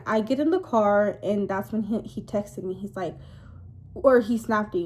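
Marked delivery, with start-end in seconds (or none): none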